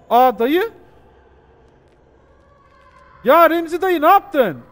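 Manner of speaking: high, loud outcry of disbelief; shocked shouting. Real, not acted. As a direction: shriek at the uncle in shock. Shocked by an in-game uncle. (Remzi dayıya şaşkınlıkla yüksek ve tiz bağırma)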